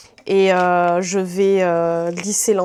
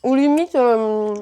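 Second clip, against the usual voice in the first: talking with mouth full; slower than usual. Real, not acted. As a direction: speak through a mouthful of food. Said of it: "je parle la bouche pleine".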